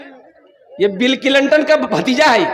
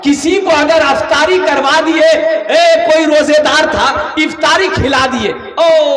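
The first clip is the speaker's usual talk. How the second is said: fervent exclaiming sermon voice; rousing preaching; 4 semitones higher, 4 dB louder than usual. Real, not acted. Preacher marvelling at feeding someone who fasts, rousing preaching. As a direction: exclaim it to stir up the crowd.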